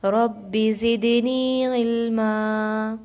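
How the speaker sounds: slow, loud melodic tajweed chant; Quran recitation. Acted. Teacher recites for students in slow chant. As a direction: draw every syllable out like a reciter.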